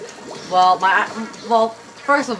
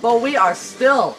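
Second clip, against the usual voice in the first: slowly drawn-out words; 3 semitones higher, slower than usual. Real, not acted. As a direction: stretch each word out. Stretching out the complaint.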